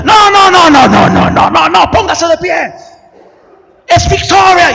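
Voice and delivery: high, loud, insistent no no no; preacher yelling. Retold or imitated. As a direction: whip the crowd to its feet. Preacher demanding more energy. (predicador exigiendo a gritos)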